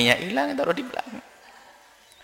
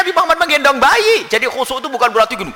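normal talking voice

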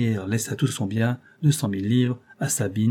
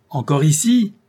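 put-on higher, louder old lady's blast; trumpeting shout; 6 semitones higher, 7 dB louder than usual. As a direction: boom it out like a trumpet. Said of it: "de sa voix plus éclatante qu'une trompette".

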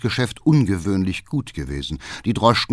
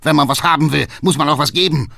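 loud, raised-pitch scolding; angry call; 6 semitones higher, 5 dB louder, faster than usual. A narrator's reading. Father scolding his demanding son, angry shout. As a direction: fire it off in anger. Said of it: "ruft der Vater zornig".

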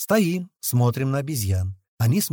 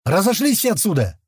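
higher, raised-voice dismissal; irritated order; 6 semitones higher, 4 dB louder than usual. A narrator's reading. Doctor clearing onlookers away from his patient, irritated order. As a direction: order them off sharply. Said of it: "зашипел на нас Док".

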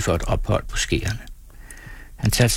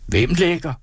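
much higher, urgent query; startled question; 9 semitones higher than usual. A narrator's reading. Crew asking from all sides, startled high question. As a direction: ask in sudden alarm, pitch jumping up. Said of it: "spurgtes der fra alle sider".

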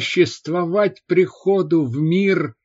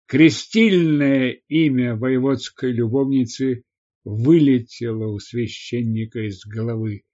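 ordinary delivery, nothing marked

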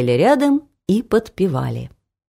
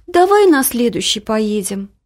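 higher, reluctant plea; trying to wriggle out; 5 semitones higher than usual. A narrator's reading. Sleepy Лариска putting mama off, reluctant. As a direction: beg off, starting high and sinking. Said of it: "пыталась отбрыкаться та".

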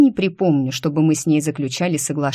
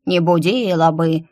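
mocking mimicry. A narrator's reading. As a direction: mimic the words back in a teasing put-on voice. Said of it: "передразнила белка". (передразнивая, исказить голосом «Не будила бы»)

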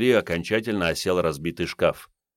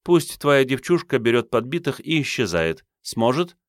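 female character voice; 7 semitones higher than usual. A narrator's reading. Katrin voiced by narrator.

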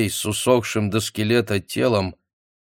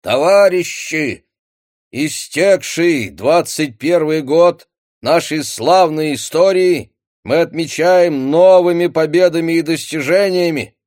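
Marshal Voroshilov; 7 semitones higher, 6 dB louder than usual. Acted. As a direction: boom it out like a Soviet broadcast. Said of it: "торжественный голос маршала Ворошилова".

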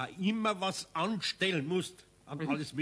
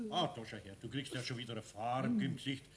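ordinary delivery

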